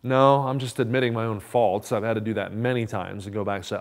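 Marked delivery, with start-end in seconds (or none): none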